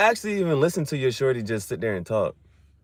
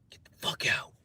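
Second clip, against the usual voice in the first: toneless whisper; 8 dB quieter than usual. Real, not acted. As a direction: whisper the threat.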